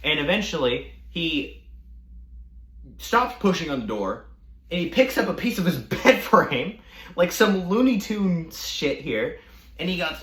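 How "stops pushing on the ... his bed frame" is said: ordinary talk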